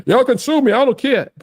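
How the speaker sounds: high-pitched imitation of a defiant man; mock defiance. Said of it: "He's like".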